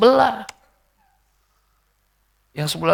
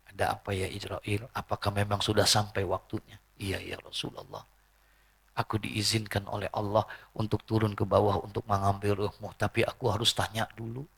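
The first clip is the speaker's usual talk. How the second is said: hushed solemn dialogue; 4 semitones lower, 10 dB quieter than usual. Retold or imitated. Acted solemn dialogue of Prophet and angel.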